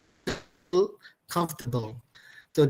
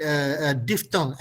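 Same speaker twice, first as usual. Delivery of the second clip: ordinary talk, nothing marked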